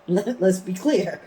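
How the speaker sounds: laughing through the words, loud and slow; real laughter. Real, not acted. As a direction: still laughing at her own punchline. Laughing after her own joke.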